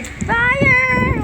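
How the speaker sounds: long drawn-out high yell; calling out fire. Pretend. Player yelling as the fire starts, drawn-out shout.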